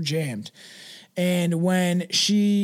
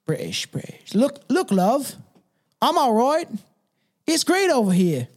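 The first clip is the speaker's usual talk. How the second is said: put-on British accent; 6 semitones higher than usual. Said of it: "getting in the Brooklyn or something".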